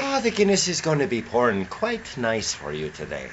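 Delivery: a mock Irish brogue; hammed-up Irish pub voice; Irish accent. Friend playing an Irishman about Guinness, mock Irish accent. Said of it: "No, that's Ireland".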